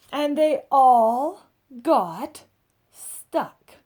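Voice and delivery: expressive read-aloud storytelling; picture book narration. A narrator's reading. Adult reading a picture book to children, lively storybook voice. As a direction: tell it like a bedtime story for kids.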